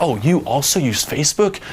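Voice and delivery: high-pitched, gushing mock delight; hammed-up excited discovery; overblown enthusiasm. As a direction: act thrilled by a trivial coincidence. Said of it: "you just overblow something that you like".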